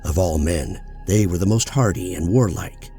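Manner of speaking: ordinary delivery, nothing marked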